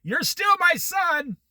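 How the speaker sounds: high, slow shouted mother; mom putting her foot down. Retold or imitated. Mother reminding her grown son who's boss, slow high shout. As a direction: slam it down like a mother. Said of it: "My mom was like".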